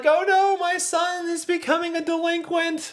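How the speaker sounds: very high, frantic mother voice; mother fretting over her son, in a very high mock wail; distressed mother. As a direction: squeal it like a horrified mom. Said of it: "she's like".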